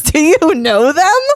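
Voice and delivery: high, loud, drawn-out disbelief; slow, loud, astonished question; incredulous brother. Retold or imitated. Brother baffled at her outburst.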